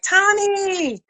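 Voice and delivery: calling her name high and bright; excited fan call. Retold or imitated.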